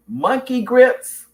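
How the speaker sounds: high, loud, drawn-out questioning echo; puzzled surprise. Real, not acted. Host reading a viewer's unfamiliar term, puzzled. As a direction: stretch the words in real bafflement. Reads unknown term, asks its meaning.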